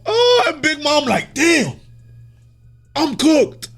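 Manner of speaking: high, loud comic wail; hammed-up high-pitched alarm; mock dismay. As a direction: wail it as a joking imagined reaction. Voices Big Mom's dismayed reaction.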